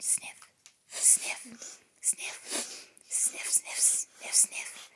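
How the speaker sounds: soft whispered sniffs; sniffing monster. Acted. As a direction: sniff the air like a hidden beast.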